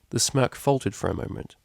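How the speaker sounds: ordinary delivery, nothing marked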